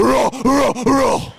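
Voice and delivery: loud, high comic roaring; mock roar. Comedian doing the song's roar loud and high. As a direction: roar it like a monster.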